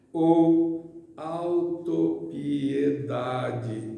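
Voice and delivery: drawn-out, syllable-by-syllable reading; reading a text aloud. Acted. Speaker reads a key word to the audience, slow drawn-out reading. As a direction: read it very slowly, stretching each syllable. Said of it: "Eu vou ler um texto aqui".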